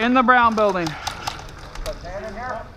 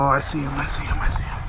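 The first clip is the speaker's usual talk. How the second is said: whisper; 5 semitones lower, 5 dB quieter, faster than usual. Real, not acted. Spotting enemy, staying hidden.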